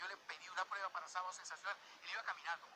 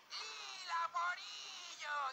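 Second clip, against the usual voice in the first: dramatic reveal; 13 semitones higher, 4 dB louder, much slower than usual. Retold or imitated. Introducing the weepy star.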